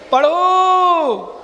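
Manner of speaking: very slow, drawn-out call; calling to recite. Real, not acted. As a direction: stretch the final vowel long to cue the class. Calls on class to recite. (पाठ के लिए लंबी खिंची पुकार)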